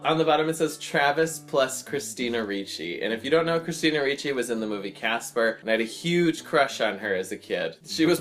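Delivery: ordinary talk, nothing marked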